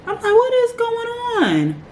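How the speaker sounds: high, drawn-out disbelief; shocked. Retold or imitated. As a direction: squeal it slowly in disbelief. Shocked by cancer news.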